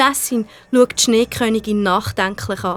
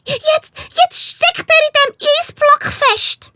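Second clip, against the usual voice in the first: very high, slow agitated cry; very high excited little voice; excited call; 4 dB louder, slower than usual. A narrator's reading. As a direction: call out in alarm at the frozen friend. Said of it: "Cindy ruft ganz aufgeregt".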